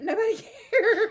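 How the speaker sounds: ordinary talk, nothing marked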